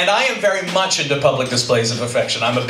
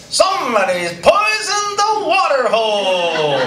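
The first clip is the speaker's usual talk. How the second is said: high, stretched-out cowboy doll cry; Woody; 11 semitones higher, slower than usual. Retold or imitated. Voice in the dark house, high drawn-out doll alarm. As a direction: wail it like a talking toy, slowly. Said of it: "I hear this voice go".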